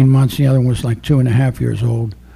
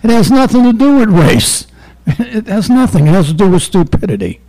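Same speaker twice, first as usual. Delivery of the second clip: much higher and louder, hammering it home; loud, heated retort; 8 semitones higher, 5 dB louder than usual. Retold or imitated. Officer fires back at the suspect, loud and heated. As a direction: shout him down with a grin. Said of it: "And I said".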